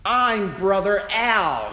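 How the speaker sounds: loud street preacher, retold; shouting preacher. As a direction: announce yourself like a street preacher. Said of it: "he shouted".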